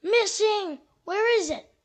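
loud, high panic over a lost leaf; frantic and alarmed; alarmist doom warning. Acted. Squirrel panics over missing leaf.

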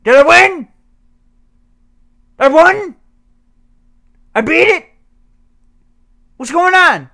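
loud high surprised questions; amazed at winning; disbelieving delight. Real, not acted. Gamer stunned to have beaten the game, loud high disbelief.

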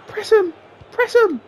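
high, loud repeated calls to press; urgent shouting. Real, not acted. Gamer shouting at his team, urgent commands. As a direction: yell at the players to close him down. Urging players during attack.

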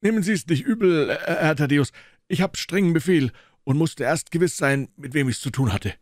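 high, loud stubborn porter voice; a guard who won't budge; gruff gatekeeper. Acted. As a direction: doorman refusing, loud and high. McMurdo apologizes heartily.

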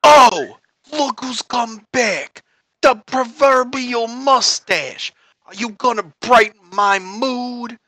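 slow, measured mob boss; mafia Don. Acted. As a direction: greet an informant like a crime boss.

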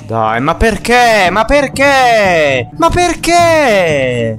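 despairing whine. Real, not acted. Repeated despairing question, building.

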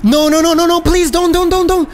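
high, rapid repeated begging; panicked pleading. Real, not acted. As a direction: plead desperately with the referee. Begging against a red card.

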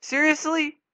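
high, loud disbelieving seriously; can't believe this is happening; exasperated. Real, not acted. Gamer stuck on a puzzle, exasperated disbelief. Frustrated at game controls.

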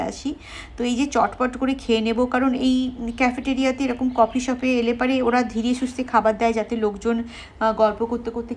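chewing while talking; just finished a bite; mouth full of food. Real, not acted. Seen 0: Bites sandwich just before; pursed, working lips, licking, wiping mouth after.

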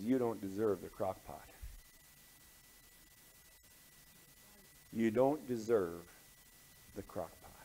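low, soft, grave reply; hushed verdict. Acted. Pastor gently rebukes his complaining friend, hushed and grave. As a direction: drop the voice and let each phrase sink in.

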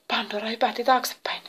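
usual speaking voice

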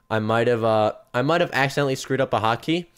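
ordinary talk, nothing marked

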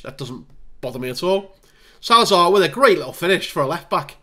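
ordinary delivery

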